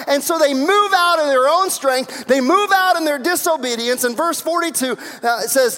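loud, high-pitched pulpit delivery; full-force preaching. Real, not acted.

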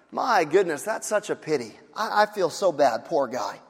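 hollow sympathy with no intent to help; mock pity. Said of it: "pity is when you would say something like".

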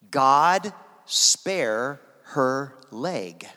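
very slow, word by word; slow, weighty prayer. Retold or imitated. Preacher reveals the translated prayer to the congregation, word by word. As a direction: let each word land separately, with space between. Retold prayer, spelt out slowly.

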